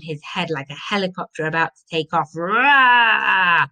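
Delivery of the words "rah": loud, drawn-out battle cry; Viking roar. A narrator's reading. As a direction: let out a long warrior's roar. Sigurd swings sword, yelling.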